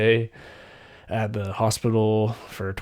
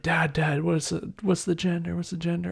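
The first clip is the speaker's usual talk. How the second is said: higher, quick and eager; eager question; 8 semitones higher than usual. Retold or imitated. Staff pressing the dad for the baby's gender, higher and eager.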